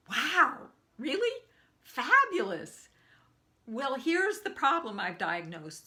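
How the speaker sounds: loud, high burst of astonishment; retold thrilled disbelief, big and loud; delighted amazement. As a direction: gush with surprise, high and emphatic. Acting out delighted surprise at luck.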